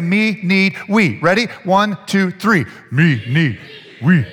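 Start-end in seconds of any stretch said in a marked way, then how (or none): 2.9-4.3 loud caveman chant in separate words. Shown as a demonstration. Preacher leads congregation in chanting together, caveman voice. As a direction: say it like a caveman, word by word. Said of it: "In your best caveman voice".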